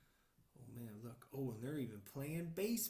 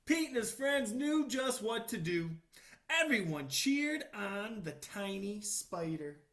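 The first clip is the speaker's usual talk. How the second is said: put-on slow, loud read-aloud for children; storybook reading; 5 semitones higher, 6 dB louder than usual. A narrator's reading. Reading the book page aloud to children, slow and loud. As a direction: read it out big and slow for little listeners.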